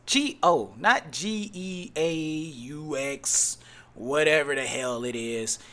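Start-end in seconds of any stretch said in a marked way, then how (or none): none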